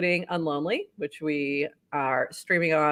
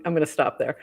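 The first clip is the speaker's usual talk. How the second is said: ordinary talk, nothing marked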